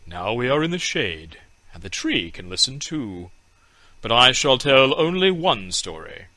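put-on an old man gathering children for a tale; little fat man telling. A narrator's reading. Little fat man offers the children a story, mildly voiced. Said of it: "He seated himself under it and said".

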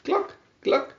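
high hen cluck. A narrator's reading. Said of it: "said the red hen".

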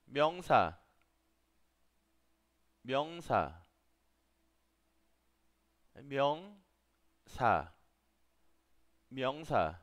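careful, loud teacher's "명사"; Korean pronunciation demo. Shown as a demonstration. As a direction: project "명사" in separate repetitions for learners. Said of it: "así es como suena".

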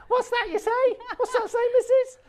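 put-on squeaky old woman asking what; high old lady voice. Mock old lady reply, very high.

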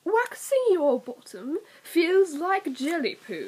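slow, crisp British read; mild British accent. Acted. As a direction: say it like a proper Brit.

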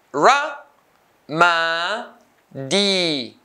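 "ramadi" as ra, ma, di with pauses; syllable by syllable. Shown as a demonstration. Teacher cutting the word into three, syllable by syllable. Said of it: "three syllables".

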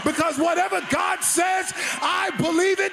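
full-force preaching. Acted. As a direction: build the congregation to a roaring finish.

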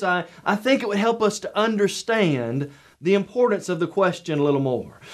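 ordinary talk

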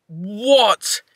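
stunned what. Real, not acted. Disbelief at hundo Psyduck.

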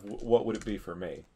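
ordinary delivery

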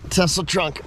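normal talking voice

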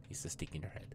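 hushed aside, voice gone to breath; whisper. Real, not acted. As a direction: whisper it to yourself. Aside while looting, hushed.

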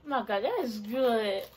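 talking with food in mouth; speaking between bites; chewing while talking. Real, not acted. Seen 1: Bites biscuit before; lips pressed, chewing motion at 1.6–1.8s.

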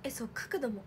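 softly whispered aside; hushed whisper. Real, not acted. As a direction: murmur it while holding the pose. Posing like the panel.

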